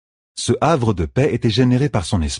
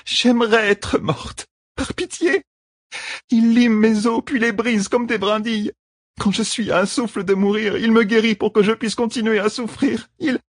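tortured woman. A narrator's reading. Woman describes her torment.

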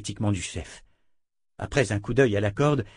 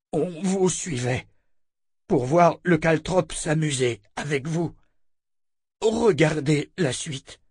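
higher, drawn-out creature voice; monster captive, talkative; 6 semitones higher, slower than usual. Acted. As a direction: tell it slowly in halting monster speech. Monster character in narrated story.

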